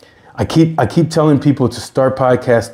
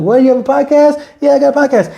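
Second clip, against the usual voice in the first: much higher, louder excited voice; surprised client; 3 dB louder, faster than usual. Retold or imitated. Friend acting out a client finding your podcast, high excited squeal. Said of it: "and you're like".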